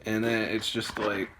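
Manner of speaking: ordinary talk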